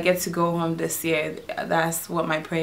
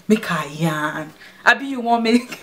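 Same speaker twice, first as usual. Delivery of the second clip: Nigerian Pidgin; 3 semitones higher than usual. Shown as a demonstration. Vlogger demonstrating her Pidgin to viewers, playful Nigerian Pidgin. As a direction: show how she sounds in Nigerian Pidgin. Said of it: "whenever I'm speaking Pidgin".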